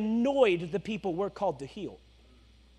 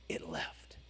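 whispered hush, much lower; 17 dB quieter than usual. Acted. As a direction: breathe it out as a hushed reveal. Dramatic pause after instantly.

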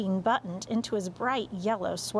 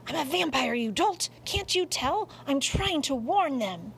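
higher, indignant cat voice; exasperated cat; 6 semitones higher than usual. Acted. Cat explaining his vampire act to the dog, exasperated.

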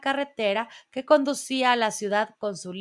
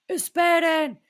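a raised, higher call of wait; 7 semitones higher, 4 dB louder, slower than usual. A narrator's reading. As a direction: call after the fleeing crowd. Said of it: "gritó George". (llamada en voz alta)

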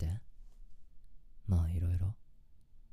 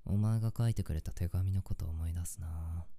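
ordinary talk, nothing marked

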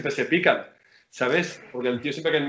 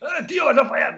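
high taunting jeer at a shooter; mock trash talk; 10 semitones higher than usual. Player imitating a rival's taunt in streetball, high mocking jeer. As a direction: needle the opponent as he shoots.

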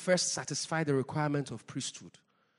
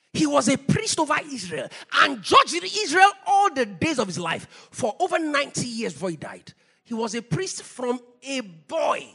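raised, higher preaching voice; forceful preaching; 7 semitones higher, 7 dB louder than usual. Real, not acted. Preacher announcing Samuel's record to the church, forceful and raised. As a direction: drive it home to the congregation.

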